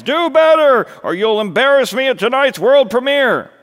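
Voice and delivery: high, loud berating yell; shrieked director's tirade, fully projected; frantic sniping. Acted. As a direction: scream at the cast before opening night. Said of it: "sniping at the remaining friends".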